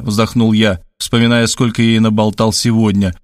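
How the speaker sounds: usual speaking voice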